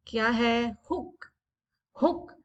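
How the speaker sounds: usual speaking voice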